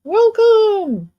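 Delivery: high, shouted welcome; loud greeting. Real, not acted. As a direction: call it out big. Greeting a viewer.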